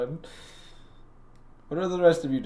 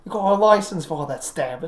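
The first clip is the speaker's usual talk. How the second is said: louder, higher mock South London tough; South London accent; 5 semitones higher, 7 dB louder than usual. Riffing on a character's South London accent, mock tough. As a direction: play a cheeky South London thug. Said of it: "a South London accent".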